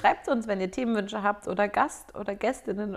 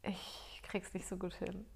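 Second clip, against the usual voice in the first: slow whispered confession; whispering; 17 dB quieter, slower than usual. Real, not acted. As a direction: breathe it out, barely voiced.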